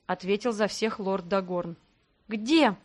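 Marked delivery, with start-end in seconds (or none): 2.3-2.8 short demanding question; mildly insistent, wanting an answer now; требовательно. A narrator's reading. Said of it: "требовательно спросила я". (требовательный вопрос)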